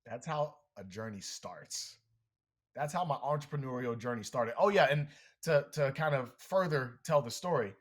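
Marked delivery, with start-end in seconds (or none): 0.0-2.0 quiet. Acted. Dramatic pause after crash story.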